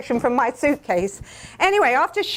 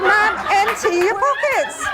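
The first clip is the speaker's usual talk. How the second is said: slow, stern, insistent guard order; a slow, stern repeat of the order; mock stern guard; 7 semitones higher than usual. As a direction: lay down the law like airport security.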